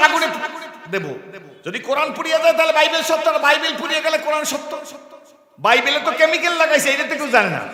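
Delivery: high, loud, fast boastful priest; hammed-up priest voice; scheming priest. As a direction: brag like a sly challenger.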